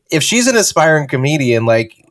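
ordinary delivery

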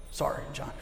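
usual speaking voice

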